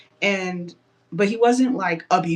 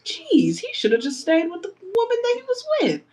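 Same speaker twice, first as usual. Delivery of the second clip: much higher and fast, fed-up commentary; exasperated rant; 11 semitones higher than usual. Real, not acted. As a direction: vent fast about how awful he is, pitch way up. Exasperated rant about the villain.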